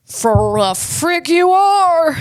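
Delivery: slow, high mock indignation; mock outrage. Colonist talking back to British taxers, mock outrage. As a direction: stretch each word in hammed-up defiance.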